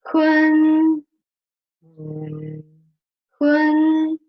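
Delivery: Hun held high and level; first tone Hun. Shown as a demonstration. Teacher repeating the high level first tone for the student. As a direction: keep Hun high and flat throughout. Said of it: "You can try".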